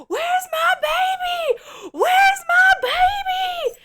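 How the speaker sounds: very high, slow, loud crying shriek; frantic wail. Retold or imitated. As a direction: wail for the lost baby in panic. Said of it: "And she's like".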